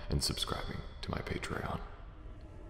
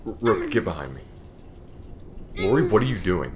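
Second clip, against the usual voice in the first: loud, higher shouted warning; alarmed shout; 7 semitones higher, 10 dB louder than usual. Acted. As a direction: yell the warning across the room. Danger, warning partner, confronting Lori.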